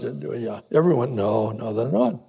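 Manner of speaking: normal talking voice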